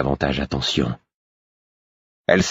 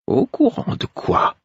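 much higher, half-whispered weary question. Acted. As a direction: ask it tiredly, not caring. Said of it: "d'un ton las".

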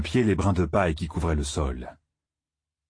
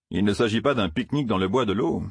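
ordinary delivery, nothing marked

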